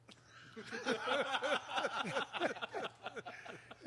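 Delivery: big hearty laugh at own joke; loud laugh. Real, not acted. Teller laughing hard at his own punchline.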